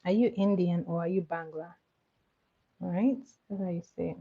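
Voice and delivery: ordinary talk, nothing marked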